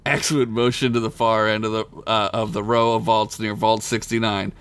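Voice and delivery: normal talking voice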